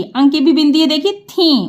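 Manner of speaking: normal talking voice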